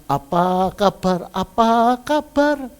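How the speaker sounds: a slow, mildly sugary greeting; polite greeting. Retold or imitated. Mother imitating the daughter's smooth suitor, mildly sugary. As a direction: mimic the overly polite suitor. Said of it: "Dari orang yang cuman".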